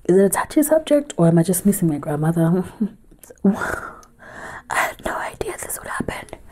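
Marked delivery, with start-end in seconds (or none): 2.8-6.4 softer, slow whisper, half laugh and half sob; embarrassed crying giggle; laughing through tears. Real, not acted. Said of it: "first time I cried".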